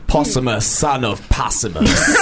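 epic Viking voice. Pretend. Jokey Viking name declaration.

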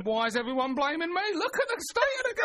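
high, put-upon cat voice; indignant cat voice. Acted. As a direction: play the cat, offended and high. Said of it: "The cat's just sitting there going".

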